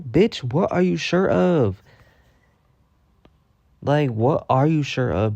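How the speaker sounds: high, drawn-out demand, pushing harder; incredulous, fed-up outburst; exasperated. Retold or imitated. Viewer challenging the woman who keeps saying she's not sure, exasperated.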